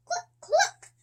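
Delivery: put-on child clucking for the chickens. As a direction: cluck like a giggling hen. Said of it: "says the chickens".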